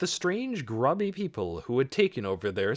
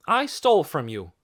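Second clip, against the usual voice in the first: higher, louder admission; bold confession; 5 semitones higher, 5 dB louder than usual. A narrator's reading. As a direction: own up firmly. Boy confesses before the crowd.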